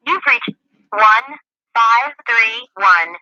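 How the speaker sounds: ordinary delivery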